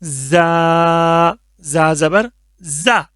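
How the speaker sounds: زا pressed hard with a buzz; a firm, whistling زا. Shown as a demonstration. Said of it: "اس کو سختی کے ساتھ پڑھیں گے".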